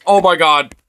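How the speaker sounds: normal talking voice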